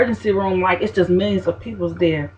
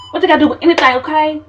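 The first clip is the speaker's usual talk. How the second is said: annoyed; 8 semitones higher, 4 dB louder, faster than usual. Real, not acted. Annoyed exclamation.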